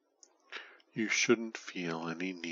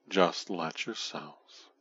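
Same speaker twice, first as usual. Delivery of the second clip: ordinary delivery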